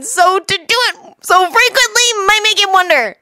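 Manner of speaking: high-pitched, anxious chatter; excitable Naruto, wincing. Acted. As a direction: babble a nervous warning up high.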